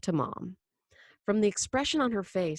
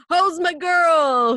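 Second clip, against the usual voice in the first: loud, drawn-out, higher-pitched greeting; booming; 10 semitones higher, 10 dB louder, much slower than usual. Acted. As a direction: boom it out to his little girl. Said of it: "he boomed".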